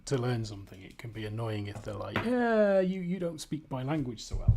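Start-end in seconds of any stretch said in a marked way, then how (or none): 2.2-4.6 imitated dismissive local, starting high and loud; mock brush-off. Imitating locals to a language learner, dismissive.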